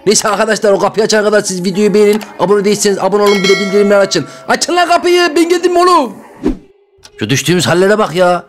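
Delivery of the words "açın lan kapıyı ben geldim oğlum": calling out. Acted. Shouting at guards to open door.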